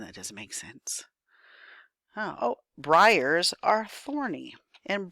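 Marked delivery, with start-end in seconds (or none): 0.0-1.0 whispered to herself under her breath. Real, not acted. Teacher puzzling over the worksheet, whispering to herself.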